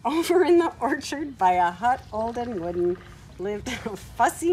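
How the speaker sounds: lively, sing-song storybook reading; reading to children. Acted. Reading a farm story aloud to children at home, lively storybook voice. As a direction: read it brightly to little ones.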